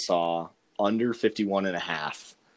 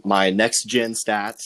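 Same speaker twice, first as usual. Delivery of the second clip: "gen" slowed and punched louder; stress on gen; 5 dB louder, slower than usual. Shown as a demonstration. Jab at coach Sarkisian, stressing "gen". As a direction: lean hard on "gen", drawn out. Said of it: "emphasis on the word gen".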